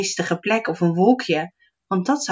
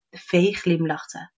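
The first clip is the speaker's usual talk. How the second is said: ordinary delivery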